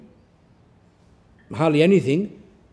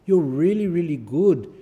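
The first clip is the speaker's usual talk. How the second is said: effusive, louder "really, really good"; gushing praise; 4 semitones higher, 4 dB louder than usual. Retold or imitated. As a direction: gush over them. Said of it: "the parents go".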